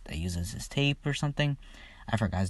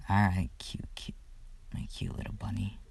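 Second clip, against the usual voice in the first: whispered, much softer pet name; teasing whisper; 2 semitones lower, 8 dB quieter than usual. Retold or imitated. Izuku teasing Mirko as he leaves, soft whisper. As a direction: breathe it close like a tease.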